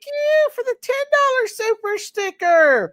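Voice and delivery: loud, high-pitched gushing thank-you; big, high celebratory shout-out; excited thanks. Real, not acted. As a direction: whoop out your thanks at the top of your voice. Thanking a donor on stream.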